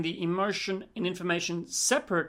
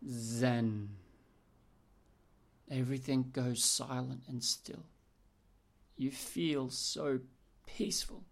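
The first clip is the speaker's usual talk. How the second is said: soft, slow meditation voice; guided meditation; 4 semitones lower, 5 dB quieter, slower than usual. Acted. Coach guiding a client through a calm morning meditation, softly. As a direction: ease them into stillness, drawing out the words.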